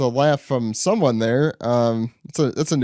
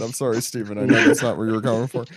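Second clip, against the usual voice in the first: usual speaking voice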